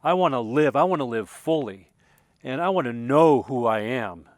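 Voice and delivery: loud, impassioned cry of longing; cried out. Retold or imitated. Alone by the fire at night, crying out to the moon, loud and impassioned. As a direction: pour it all out, calling to the night sky. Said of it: "What, what came out of my mouth was".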